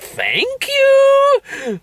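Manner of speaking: very high, exaggerated thanks; mock gratitude. Host replaying reply to a backhanded comment, squealed thanks. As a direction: shoot the pitch way up. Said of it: "It was like".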